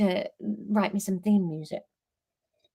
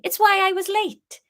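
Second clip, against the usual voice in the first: high and louder Welsh lilt; cheerful Welsh Harry; 13 semitones higher, 5 dB louder than usual. Acted. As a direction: chirp it proudly in Welsh. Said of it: "Harry said cheerfully".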